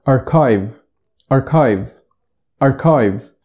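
أركايف said "AR-kive", hard k and long i; أركايف with a k, never sh; correct English pronunciation. Shown as a demonstration. Teacher modelling archive after rejecting أرشيف. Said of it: "ما بتتقالش أرشيف".

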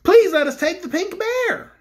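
high, slow pleading; begging. A narrator's reading. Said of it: "begged Cake".

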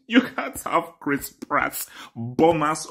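ordinary talk

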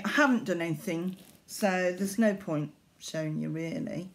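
ordinary delivery

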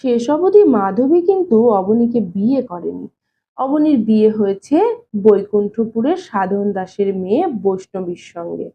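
ordinary delivery

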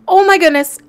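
loud, high, quick burst of delight; squealing with joy; excited shriek. Real, not acted. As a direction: blurt it out thrilled. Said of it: "I am so excited!".

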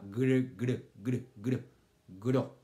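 GR repeated in short bursts, then GRO; isolated syllable sound. Shown as a demonstration. Teacher drawing children's ears to that syllable, isolated sound. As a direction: punch out GR several times, then GRO. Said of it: "Oiga, esa sílaba".

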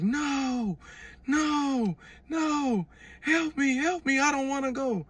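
high, drawn-out cries of panic; screaming plea. Retold or imitated. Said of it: "was screaming".

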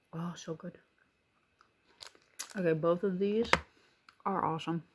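talking between bites; chewing while talking. Real, not acted. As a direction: mouth partly full. Seen 0: chews before and between phrases, bites the cup again at 3.5.

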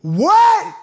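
a high, loud, falling what in disbelief; stunned what. Real, not acted. Vlogger reacting to a diss track, stunned shout. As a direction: blurt it out, shocked by the line.

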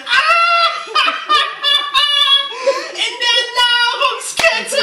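high, loud laugh, then slow laughing repeat; burst of laughter. Acted. Timon mocking the lion cub's rank, laughing loudly. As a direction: crack up and drag out the phrase.

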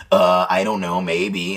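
very low demonic droning; deep throat voice. Shown as a demonstration. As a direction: drop into a deep unnatural drone. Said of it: "that voice".

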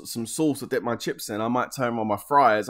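ordinary delivery

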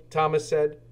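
plain reading voice

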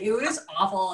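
normal talking voice

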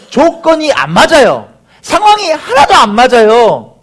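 high, loud mock protest; protesting believer. Believer facing money troubles objecting to Jesus, loud complaint. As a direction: push back hard, each complaint pitched high.

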